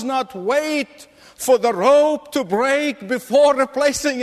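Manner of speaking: rising, impassioned sermon climax; fervent preaching. Acted. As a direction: preach it with mounting urgency. Builds to a passionate exhortation.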